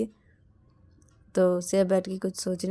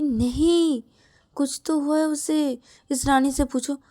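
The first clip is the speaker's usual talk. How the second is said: loud, higher insistence; insistent; 7 semitones higher, 6 dB louder, slower than usual. Acted. Shreya insists to Shruti something is wrong, loud and higher. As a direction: insist hard, cutting her off.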